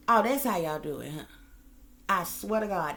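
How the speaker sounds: usual speaking voice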